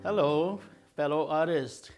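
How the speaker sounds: normal talking voice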